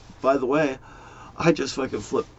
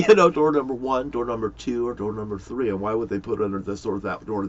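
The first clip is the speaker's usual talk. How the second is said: ordinary talk, nothing marked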